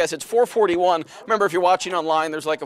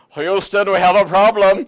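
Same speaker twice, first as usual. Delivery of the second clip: mock German accent, loud on the punchline; hammed-up German-accented English; 7 dB louder, slower than usual. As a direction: imitate a German cab driver. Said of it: "stop doing your German accent".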